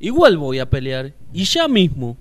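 grito obstinado, mucho más fuerte; terco y a gritos. Acted. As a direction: insiste gritando. El Piojo insiste en pelear. (el Piojo grita terco, más fuerte)

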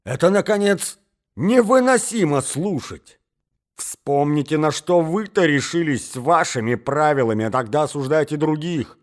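high, loud indignant tirade; outraged cry. Acted. Accused suitor turns the blame back on her, outraged. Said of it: "вскричал он".

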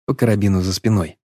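ordinary delivery, nothing marked

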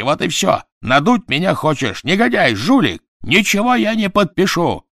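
high, loud furious shouting; berserk rage. Acted. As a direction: rage at them, out of control. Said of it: "в бешенстве закричал Квидер".

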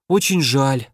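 ordinary delivery, nothing marked

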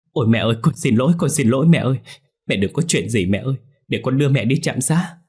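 loud, fast, anguished begging; crying out loud. Acted. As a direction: son cries out to his fainted mother. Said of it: "ông kêu lớn".

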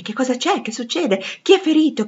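a confused crowd demanding to know; alarmed questioning. A narrator's reading. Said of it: "chiedevano tutti alla rinfusa". (domande concitate e allarmate)

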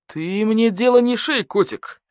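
slow, high, mildly menacing wolf; baring fangs. A narrator's reading. Wolf warns the investigator off framing him, baring his fangs. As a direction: warn him off with bared teeth. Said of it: "оскалился волк".

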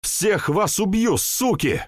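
high, slow raging threat; furious shout. Acted. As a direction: scream it at them in grief and rage. Yan threatens after friend's death.

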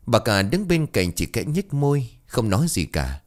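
usual speaking voice

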